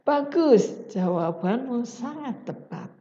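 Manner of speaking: the teacher shows how warm praise should sound; warm, enthusiastic praise. Shown as a demonstration. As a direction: praise the student with real enthusiasm. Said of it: "Hangat dan antusias".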